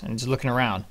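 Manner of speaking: ordinary delivery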